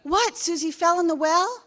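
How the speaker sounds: high, loud alarmed mock cry; mock Lassie owner. Acting out the ad, mock alarmed cry. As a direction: ham up a panicked owner. Mock dog-rescue ad scene.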